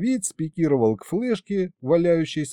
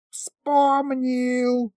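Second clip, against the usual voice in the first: high, long-held cry of remembering; very high, stretched exclamation; sudden wail; 12 semitones higher than usual. A narrator's reading. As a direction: shriek it out in sudden triumph. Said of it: "завопил вдруг мировид".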